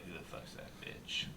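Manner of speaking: hushed catty whisper. Retold or imitated. Retold cousin's jealous remark.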